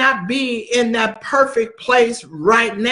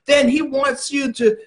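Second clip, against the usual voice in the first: normal talking voice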